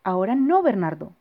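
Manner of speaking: put-on loud, brushing the child off; dismissive parent. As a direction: tell him not now, busy and curt. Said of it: "dijo su papá".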